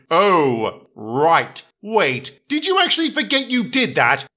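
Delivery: baffled Edward. Acted. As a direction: rise up in disbelief.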